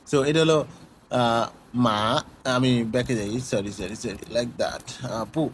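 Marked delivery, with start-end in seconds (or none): none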